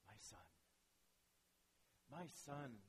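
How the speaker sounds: soft, tender plea to a son; gently reach out to a hurt son. Acted. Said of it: "there's a tenderness there".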